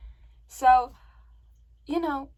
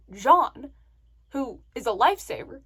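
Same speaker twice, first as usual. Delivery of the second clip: ordinary talk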